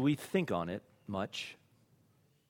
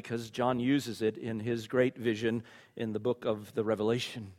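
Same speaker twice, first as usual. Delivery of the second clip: ordinary delivery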